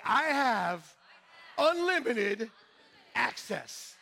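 high, loud, with a laugh; excited declaration. Shown as a demonstration. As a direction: shout it out joyfully, laughing.

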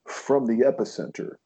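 mock live TV reporter delivery; special newscaster voice. Comedian mocking fake news live reports, put-on newscaster voice. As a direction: put on a reporter's voice, drawing out the last word. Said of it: "you've got the special voice".

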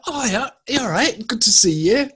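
high, bright put-on greeting to a face he can't place; fake cheery greeting. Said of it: "It's like".

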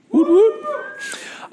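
high squeaky cheer, playful; a quick playful whoop; woot cheer. Real, not acted. Youth pastor cheering about camp, high playful whoop.

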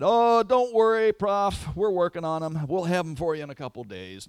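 high, loud, breezy student voice; careless student. Retold or imitated. A student brushing off the professor, high and breezy. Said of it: "One student replied".

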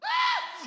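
very high-pitched scream of fright; mock terrified squeal; frightened scream. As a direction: let out a comic child's shriek. Acts out his childhood fright.

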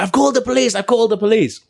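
high, frantic repeated call; the pizza guy shouts at the brawlers, high mock panic; mock panicked shout. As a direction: cry it out in alarm.

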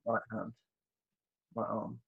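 faint murmur. Retold or imitated. Relearning to move his body.

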